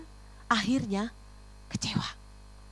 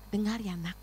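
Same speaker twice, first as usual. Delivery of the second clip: God speaking tenderly; 2 semitones lower, 10 dB quieter than usual. Retold or imitated. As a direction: speak softly as a father to his child. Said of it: "waktu Tuhan bicara dengan saya".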